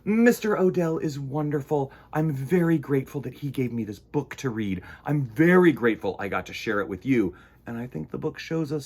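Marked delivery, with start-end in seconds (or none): none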